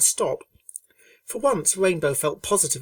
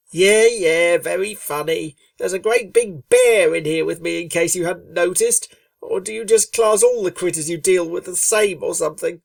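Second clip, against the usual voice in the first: louder, higher, sarcastic and edgy; sarcastic Rainbow; 3 semitones higher, 8 dB louder than usual. A narrator's reading. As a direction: needle her friend while rattled by the bear. Rainbow sarcastic, tense near bear.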